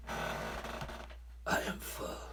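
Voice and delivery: put-on very slow, breathy whisper of a kid too full; stuffed groan. Reader acting a stuffed kid, groaning breathy whisper. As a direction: sigh it out, slumped in the chair, hugely slow. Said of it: "you can really make".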